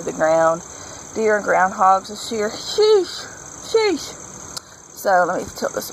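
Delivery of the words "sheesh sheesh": high, drawn-out exasperated sheesh. Real, not acted. Gardener fed up with deer and groundhogs, exasperated. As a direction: sigh it out, fed up with the pests.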